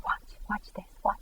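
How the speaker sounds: whispered urging to watch; hushed whisper. Retold or imitated. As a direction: whisper it as a secret aside. Jesus urging her to watch.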